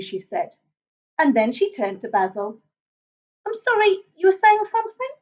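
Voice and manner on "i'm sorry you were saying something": high, courteous enquiry; high-voiced genteel monster; polite monster mother. A narrator's reading. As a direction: enquire politely in a raised pitch. Monster mother politely turns to Basil.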